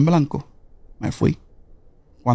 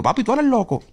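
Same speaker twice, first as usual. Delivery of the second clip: high, incredulous protest; retold agitation; 9 semitones higher than usual. Patient objecting to the doctor's verdict, high agitated retort. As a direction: jump up in pitch, imitating how worked up you got. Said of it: "Yo me alteré".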